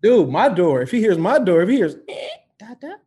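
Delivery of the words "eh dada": put-on tiny, high, hushed toddler voice; toddler calling. Waking toddler hears dad's door, softly calling.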